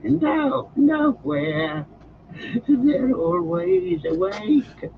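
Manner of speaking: high, broken, sobbing Gollum; anguished, lost creature. Acted. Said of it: "his voice and language changed, and he sobbed".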